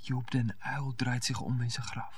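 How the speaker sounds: husband whispering a mock-solemn joke; hushed mock solemnity. [name] hushes the room.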